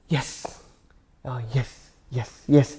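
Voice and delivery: low, soft, unsure yeses; meek pretend agreement. Baffled newcomer answering a local, meek soft agreement. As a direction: nod along quietly without understanding. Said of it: "Gue cuman".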